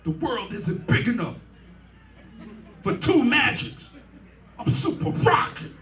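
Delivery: mock radio-DJ swagger, pushed high at the end; imitating Mister Magic. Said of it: "with his distinctive voice".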